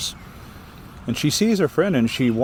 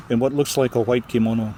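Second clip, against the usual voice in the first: usual speaking voice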